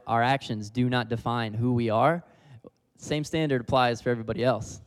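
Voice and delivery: ordinary delivery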